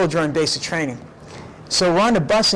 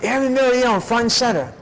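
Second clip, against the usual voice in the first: barked command; 7 semitones higher than usual. Retold or imitated. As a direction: summon the recruit in front of the troops. Drill sergeant calls him forward.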